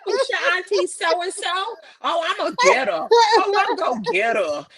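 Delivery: high, loud teasing man voice; zesty hammed-up impression; imitating a man. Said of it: "You sound just like him".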